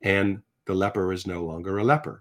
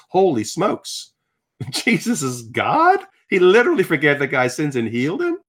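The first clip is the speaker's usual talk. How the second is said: high, loud, amazed disbelief; mind blown; 7 semitones higher, 5 dB louder than usual. Retold or imitated. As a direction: relive the astonishment, stretching the first words. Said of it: "It's blowing my mind".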